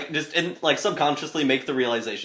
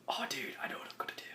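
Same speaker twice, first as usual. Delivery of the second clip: fast, voiceless whisper; excited whisper; 20 dB quieter, much faster than usual. Real, not acted. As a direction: whisper your sudden idea quickly. Player hatches a plan.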